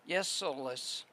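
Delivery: ordinary talk, nothing marked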